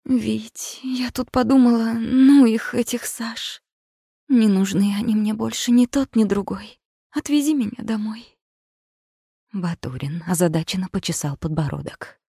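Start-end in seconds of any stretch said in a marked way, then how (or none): 0.0-8.4 higher, breathy coaxing woman's voice; sultry woman. A narrator's reading. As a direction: sigh it out languidly. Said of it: "выдохнула томно".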